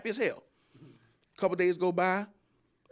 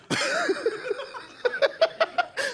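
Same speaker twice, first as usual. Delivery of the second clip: high squealing laugh. Real, not acted. Comedian laughing at his own punchline.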